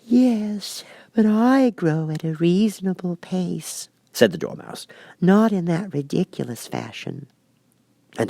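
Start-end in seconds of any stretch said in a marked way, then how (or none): 0.1-3.9 high, slow little dormouse voice; a small creature protesting mildly; sleepy Dormouse. A narrator's reading. Dormouse objects to the Queen's fast growth, high and slow. 5.2-7.3 a small creature protesting mildly; sleepy Dormouse. A narrator's reading. Dormouse continues.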